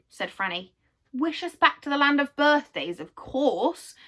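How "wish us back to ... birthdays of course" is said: louder, higher eager shout; excited yell. Acted. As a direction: shout it all together. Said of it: "yelled everyone else in excitement".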